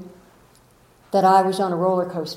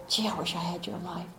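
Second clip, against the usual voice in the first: mock envious sigh; 11 dB quieter, faster than usual. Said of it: "I don't look and say".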